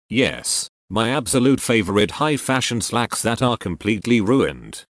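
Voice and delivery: ordinary delivery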